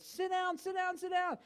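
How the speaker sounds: a hammed-up pilot shouting at passengers to sit; pilot yelling. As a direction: bark the order like a frantic pilot. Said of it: "the pilot started screaming and yelling".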